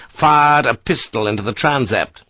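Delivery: ordinary delivery, nothing marked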